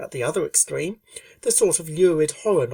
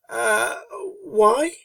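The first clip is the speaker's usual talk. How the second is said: puzzled; 8 semitones higher, 5 dB louder than usual. A narrator's reading. Rainbow puzzled question.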